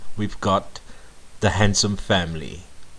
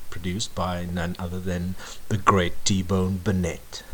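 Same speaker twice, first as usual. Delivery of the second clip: ordinary talk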